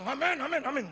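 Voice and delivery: loud glad amens; happy loud amens; joyful amen. Retold or imitated. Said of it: "Saya senang".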